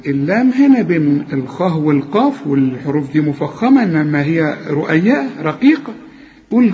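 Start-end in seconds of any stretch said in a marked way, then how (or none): none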